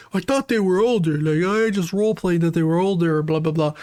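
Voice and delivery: lightly voiced mocking whine of a caught creep; a hint of a caught predator making excuses. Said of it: "the person will be like".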